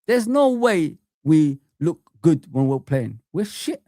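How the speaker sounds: usual speaking voice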